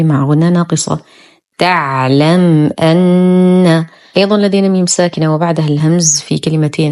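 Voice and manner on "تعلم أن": slow تعلم أن, closed م released cleanly before the hamza; clear meem. Shown as a demonstration. Teacher shows meem before hamza, clearly pronounced. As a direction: recite تعلم أن slowly, crisp م, held ن. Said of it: "الحكم إظهار شفوي".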